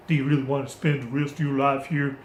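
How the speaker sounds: badly faked American movie voice; bad American accent. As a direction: attempt an American accent, clumsily. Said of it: "That was my bad American accent".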